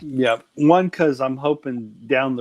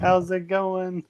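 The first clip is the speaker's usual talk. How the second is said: slow, drawn-out neighborly greeting; higher-pitched, unhurried neighbor impression; Brother Brett; 6 semitones higher, slower than usual. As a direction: stretch it out like a friendly over-the-fence hello. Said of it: "That's Brother Brett".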